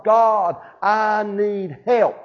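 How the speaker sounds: slow, drawn-out mock cry for help; imitated plea. Preacher voices Jesus praying on the mountain, drawn-out plea. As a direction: plead it slowly, lightly acted. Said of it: "there was a cry in his heart".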